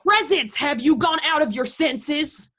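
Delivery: high-pitched, indignant challenge; outraged scolding. Acted. Violent quarrel, mother scolds son.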